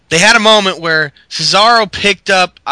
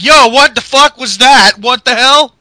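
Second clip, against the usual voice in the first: loud, high mock yell; Ryback yelling; 6 semitones higher, 7 dB louder than usual. Ryback yelling at the ring, mock loud and high. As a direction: yell it in confusion. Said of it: "Ryback's like".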